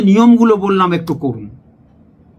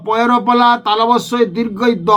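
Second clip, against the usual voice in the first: ordinary talk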